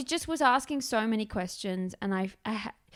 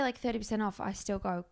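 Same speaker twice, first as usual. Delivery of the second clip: usual speaking voice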